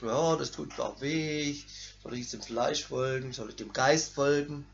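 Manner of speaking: low, soft idle musing; lazy sofa musing. Acted. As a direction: muse lazily from the sofa. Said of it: "zurücklegen in dein Sofa".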